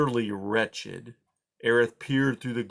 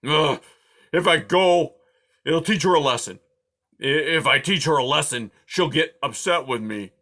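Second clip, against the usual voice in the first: loud, higher porcupine arguing with himself; grumpy porcupine arguing; 4 semitones higher, 6 dB louder than usual. A narrator's reading. Aerith reasoning with himself about Poppy, louder and higher. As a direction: talk yourself round in a huff. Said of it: "he told himself".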